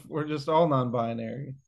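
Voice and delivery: normal talking voice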